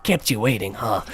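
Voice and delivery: lightly put-on game soldier voice; Snake. Streamer showing the only line they can do, soldier impression. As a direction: slip into a game hero's catchphrase voice. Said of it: "in a Snake impression".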